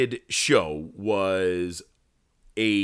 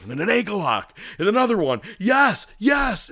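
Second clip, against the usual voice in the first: climbing from higher to shouted, hammed-up yeses; mock ecstasy; 11 semitones higher, 4 dB louder than usual. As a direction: build the fake ecstasy up into a yell. Retelling the faked orgasm scene.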